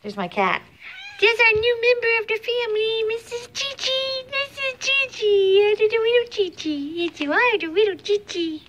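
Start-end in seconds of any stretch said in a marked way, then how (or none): 1.2-8.6 high cooing pet voice. Real, not acted. Vlogger cooing over her new cat, high baby talk.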